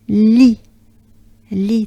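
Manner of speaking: "li" as bright "lee" with wide smile; smiling French li. Shown as a demonstration. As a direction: smile widely through "li". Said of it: "two big smiles".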